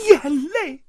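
high, loud sobbing-laughing wail; hysterical wailing. Acted. Aunt wailing hysterically over the word tears. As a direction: break down between laugh and sob. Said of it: "姨妈歇斯底里地嚎哭起来".

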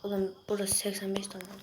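unvoiced whispered aside. Real, not acted. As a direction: murmur it under your breath. Fısıltıyla sayıyor. (fısıltıyla söylenen ara söz)

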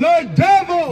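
high-pitched proclamation of the verse; scripture declamation. Real, not acted. Reader answering the preacher's "The who?", declamatory.

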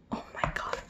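hushed, breathless amazement; awed whisper. Real, not acted. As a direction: whisper it in real awe. Awed reaction to fan merchandise.

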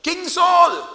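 David shouting to wake King Saul; shouted call. Retold or imitated. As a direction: bellow his name over the valley. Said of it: "he shouts".

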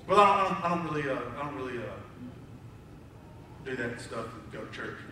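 low, very soft, halting evasion; sheepish mumble. Retold or imitated. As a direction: imitate someone trailing off with a lame excuse. Acting out an evasive, sheepish reply.

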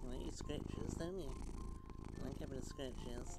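soft cat praise. Real, not acted. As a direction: coo softly to the cat.

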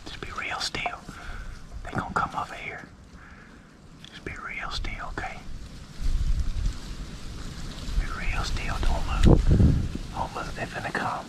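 very soft, urgent whisper to keep still; hushed whisper. Real, not acted. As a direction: whisper it so the ducks won't hear. Hunters hiding from approaching ducks.

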